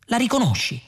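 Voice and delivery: insistent question. A narrator's reading. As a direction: press the question with a high, falling tune. Question repeated, now insistent.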